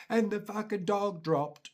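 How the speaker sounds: ordinary talk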